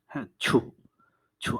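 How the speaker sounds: ছু said between ছ and ঝ; Korean ju sound. Shown as a demonstration. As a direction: say ছু halfway between ch and jh. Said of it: "ছ আর ঝয়ের মাঝামাঝি".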